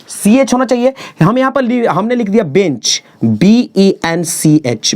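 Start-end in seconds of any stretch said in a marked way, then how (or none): 3.2-4.9 slow spelling. Shown as a demonstration. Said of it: "spelling out bench".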